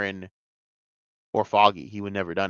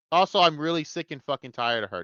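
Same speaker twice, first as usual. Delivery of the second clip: usual speaking voice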